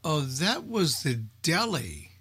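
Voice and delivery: normal talking voice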